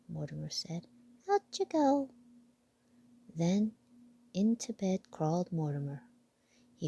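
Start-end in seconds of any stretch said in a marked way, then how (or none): 1.3-2.1 high, firm little mouse; piping command; bossy mouse voice. Acted. Mouse evicts statue.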